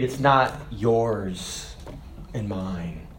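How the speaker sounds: ordinary talk, nothing marked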